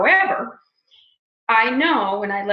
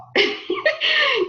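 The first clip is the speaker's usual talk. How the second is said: burst of laughter. Real, not acted. Laughing at her own line.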